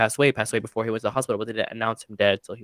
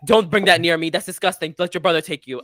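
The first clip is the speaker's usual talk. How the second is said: louder, higher dismissive mom; mock disgusted mom; 5 semitones higher, 5 dB louder than usual. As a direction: brush it off in disgust.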